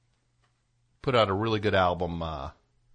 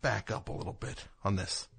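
quiet; 11 dB quieter than usual. Retold or imitated. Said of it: "you're kinda like".

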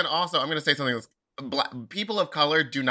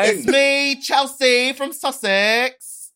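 put-on Essex accent; 8 semitones higher, 7 dB louder than usual. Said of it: "Meatball's character, Chelsea".

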